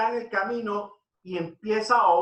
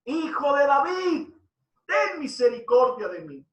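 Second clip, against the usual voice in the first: high mock shout for mercy; imitated crying out; 7 semitones higher than usual. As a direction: retell a blind man's cry, pitched well up. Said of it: "Y empezó a gritar". (grito imitado pidiendo misericordia)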